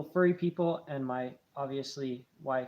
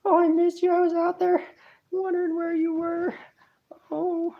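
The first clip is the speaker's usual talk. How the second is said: much higher and louder, gushing pet voice; excited mink voice; 11 semitones higher, 7 dB louder than usual. Pretend. Owner voices his mink greeting him, high excited squeal. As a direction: squeal it out like an overjoyed little mink. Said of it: "He's like".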